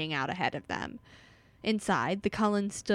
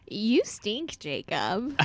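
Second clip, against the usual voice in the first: lightly drawled mock insult, rising on the name; Lynette-like character voice; 2 semitones higher, much slower than usual. A narrator's reading. Narrator voicing Bella's blurted insult, lightly put-on voice. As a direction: read the jab with a hint of a silly character. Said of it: "I hear that in Lynette's voice".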